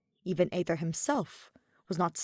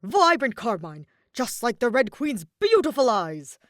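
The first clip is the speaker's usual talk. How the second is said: loud, starry-eyed praise; admiring soldier; 4 semitones higher, 8 dB louder than usual. A narrator's reading. Soldier praising the queen to Aether, loud and admiring. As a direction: gush over the queen's eyes.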